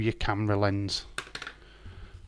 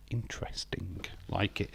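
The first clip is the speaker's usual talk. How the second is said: soft whispered aside; whispering; 3 semitones lower, 13 dB quieter than usual. Real, not acted. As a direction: breathe it out under your breath.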